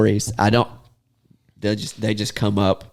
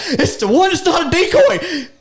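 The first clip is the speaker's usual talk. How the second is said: high, loud answer with a laugh in it; exasperated and laughing; exasperated laughing shout; 4 dB louder than usual. Retold or imitated. Answering the shooters in panic, exasperated laughing shout. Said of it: "I'm like".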